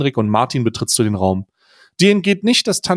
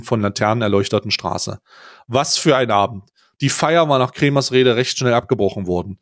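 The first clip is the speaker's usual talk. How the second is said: ordinary talk, nothing marked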